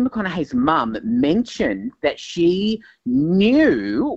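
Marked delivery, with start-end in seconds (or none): 2.2-4.2 "knew" drawn out with a rising tune; stress on knew. Real, not acted. Entertainment reporter hinting at an affair, heavy emphasis on "knew". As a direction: lean hard and long on "knew", lifting it knowingly. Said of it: "with an emphasis on the word knew".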